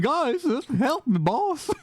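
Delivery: slow, very high put-on whine; high drawn-out plea; high pleading thug. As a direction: beg the boss to come help. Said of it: "another pers- performance".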